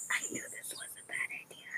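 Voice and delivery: fast, hushed whisper under the breath; whispered aside. Acted. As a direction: mutter your regret so she can't hear. Boy grumbles aside to himself.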